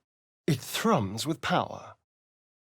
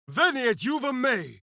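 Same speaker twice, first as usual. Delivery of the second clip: spell incantation; 11 semitones higher than usual. Acted. Casting a spell.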